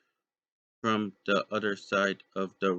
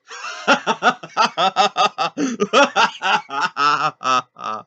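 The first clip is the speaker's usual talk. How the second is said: loud high hysterical cackle; hysterical laugh; 8 semitones higher, 10 dB louder than usual. Acted. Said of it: "laughs hysterically".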